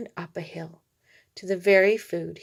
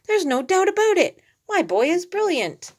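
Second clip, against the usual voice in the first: much higher, louder thrilled praise; excited; 10 semitones higher, 4 dB louder than usual. A narrator's reading. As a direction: burst out proud and thrilled. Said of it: "said Doggy Daddy excitedly".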